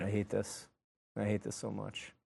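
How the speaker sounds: low, very soft grumble; dreading mutter. Real, not acted. Host about to be shocked, dreading it in a low mutter. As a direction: mutter it miserably under your breath.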